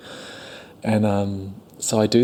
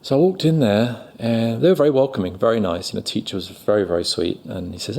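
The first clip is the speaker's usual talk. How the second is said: ordinary delivery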